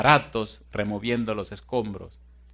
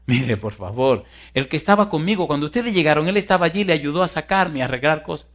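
high, louder pleading explanation; acted urgent insistence; 6 semitones higher, 7 dB louder than usual. Rescued woman insists to captain about rescuer, urgent. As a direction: press the point in a raised voice.

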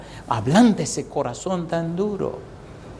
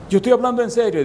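higher, insistent protest; a hint of an offended young woman; girl insisting to the pastor, pitched high; indignant girl; 8 semitones higher, faster than usual.

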